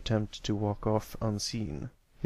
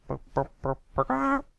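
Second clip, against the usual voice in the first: silly mock burps, the last squeaked much higher; taunting fake burps rising to a squeak; mocking burp noises. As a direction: burp sounds made to mock a classmate.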